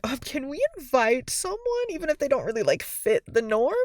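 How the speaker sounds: coy, tentative question; imitating Marinette. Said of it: "Marinette is, like, asking the girls".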